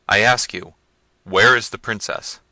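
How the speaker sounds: loud, insistent question; demanding prince. A narrator's reading. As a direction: press the servants loudly for an answer. Manfred demands an answer.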